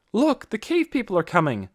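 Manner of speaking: high, rising alarmed call; alarmed shout. A narrator's reading. Villagers at their windows shouting in alarm. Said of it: "They shouted to each other".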